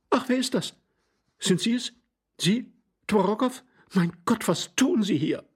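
very high, startled questions; startled lady. A narrator's reading. Glafira suddenly recognises Tworogov, startled and high-pitched. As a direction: gasp out each question in a high voice.

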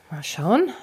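soft whispered aside. Real, not acted. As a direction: whisper it to yourself. Checks step counter quietly.

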